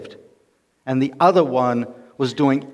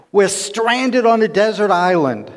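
furious shout; 6 semitones higher than usual. Acted. Stranded man yelling at his idle companion, furious. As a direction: shout at him in outrage. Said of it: "Finally, he's getting downright furious".

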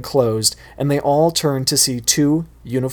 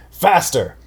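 higher, punchy shout; 6 semitones higher than usual. Acted. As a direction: snap the command out. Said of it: "he shouted".

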